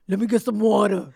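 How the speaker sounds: loud, mock manly request for water; imitated morning voice. Imitating her morning voice that her ex teased, mock manly. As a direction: put on a mannish just-woke-up voice. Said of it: "my ex used to be like".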